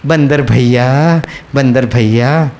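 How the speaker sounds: low, drawn-out, sweet pleading; loving coaxing. Retold or imitated. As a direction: imitate a woman tenderly coaxing a monkey. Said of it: "बहनें बड़े प्यार से बोलते". (बड़े प्यार से मनाते हुए)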